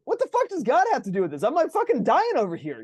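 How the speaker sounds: high, loud incredulous rant; exasperated outburst. Retold or imitated. Patient retelling outrage at the doctor, loud high exasperation. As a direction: vent like you can't believe what you heard. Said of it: "it's like".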